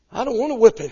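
put-on high, loud, whiny child's plea; pleading little boy. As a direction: beg your mother like a scared kid. Boy pleading with his mother.

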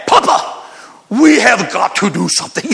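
son's Kenyan accent, lightly imitated; a light Kenyan accent for the urgent plea. Son urging Papa about the wells, light Kenyan accent. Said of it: "He's got this accent".

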